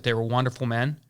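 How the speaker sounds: usual speaking voice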